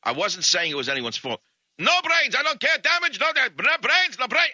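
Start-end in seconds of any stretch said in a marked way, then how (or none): 1.8-4.5 put-on high-pitched yelling in a thick Russian accent; screaming Russian. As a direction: rant like a crazed Russian whose bumper got hit. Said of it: "he's on and on with the no brains".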